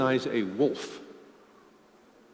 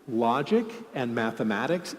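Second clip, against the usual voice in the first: ordinary talk, nothing marked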